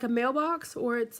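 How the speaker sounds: ordinary talk, nothing marked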